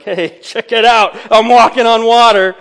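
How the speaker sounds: a boastful laugh as he shows off; cocky showing off. Retold or imitated. Peter turning to the disciples, gloating mid-laugh, showing off. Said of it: "as if to be like".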